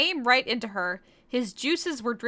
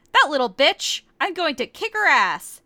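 louder, higher mock fury; mad; 5 semitones higher, 6 dB louder than usual. Korra raging about Kagome, loud mock anger. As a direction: spit it out like a hammed-up rage. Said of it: "Korra got mad".

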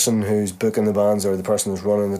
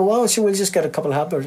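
higher, put-on promoter voice; 7 semitones higher, faster than usual. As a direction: voice the promoter lightly.

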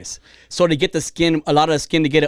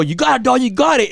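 imitated gym cheer, pitched up; spotter's encouragement; 8 semitones higher than usual. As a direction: urge on the lifter, higher than usual. Voicing a spotter cheering a lifter.

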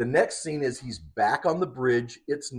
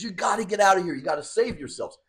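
higher, mildly acted alarm; urgent warning; 6 semitones higher, faster than usual. Retelling captain warning huddled people to save themselves, urgent. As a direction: push the pitch up as if hurrying people out.

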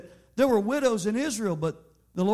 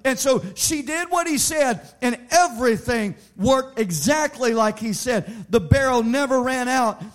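rising preaching; 4 semitones higher, 7 dB louder than usual. Acted. Preaching at full force.

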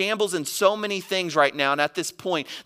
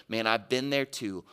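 soft, low reassurance, dropping to very quiet; humble empathy, voice sinking low and soft; gentle, humble; 4 semitones lower, 7 dB quieter than usual. Acted. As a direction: offer help quietly, without judgment. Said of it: "Humbly says".

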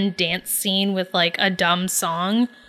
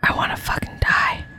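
whisper. Real, not acted. Cringing at the scene.

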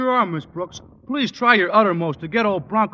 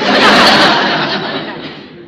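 laugh; 10 dB louder than usual. Real, not acted. Stretch laughs at the question.

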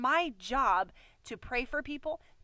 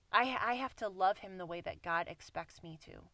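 normal talking voice

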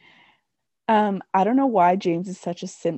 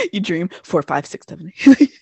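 usual speaking voice

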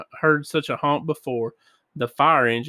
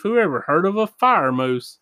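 ordinary delivery, nothing marked

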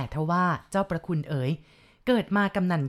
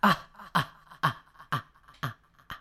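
mocking laugh. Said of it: "พร้อมกับเสียงหัวเราะเยาะ".

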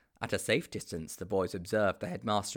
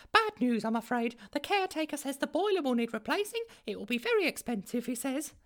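much higher, anxious secretary voice; fretful school secretary. A narrator's reading. The school secretary reports the boiler to the headmaster, fretful. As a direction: hurry up with bad news.

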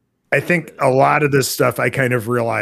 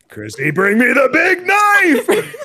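high laughing mock yell of the line; mock shout; 13 semitones higher, 4 dB louder, slower than usual. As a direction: bellow the order at Chrissy through a laugh.

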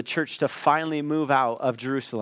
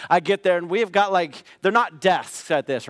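normal talking voice

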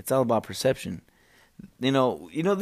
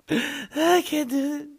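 gasp, then a much higher, slow, hammed-up wail; melodramatic gasp; slower than usual. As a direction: play at falling apart, slow and high. Mock despair at being alone.

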